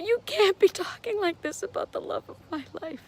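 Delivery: hurt plea to the doctors; tearful. Real, not acted. Widow recalling doctors' talk, tearful protest.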